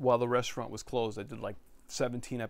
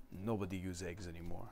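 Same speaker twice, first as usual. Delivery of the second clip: low, soft imitation of an Italian accent; a hint of the dismissive Italian instructor; 6 semitones lower, 9 dB quieter than usual. Quoting the instructor brushing off eggs, mild Italian accent. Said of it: "so they have Italian accent".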